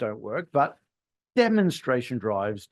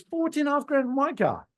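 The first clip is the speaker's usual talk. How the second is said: customer demand; 12 semitones higher than usual. Retold or imitated. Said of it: "Customer says".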